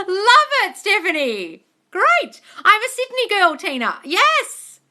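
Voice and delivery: thrilled squeals to the viewers; excited. Real, not acted. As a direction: gush with delight.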